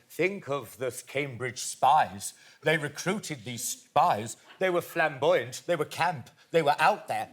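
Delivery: slow, measured lecturing impression; Stephen Fry. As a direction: imitate a learned man expounding at the table, unhurried. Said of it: "Stephen Fry was going".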